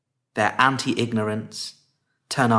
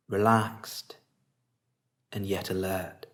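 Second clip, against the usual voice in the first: guided meditation; 2 semitones lower, 4 dB quieter than usual. Real, not acted. Teacher guiding listeners to relax face and body, calm meditation voice.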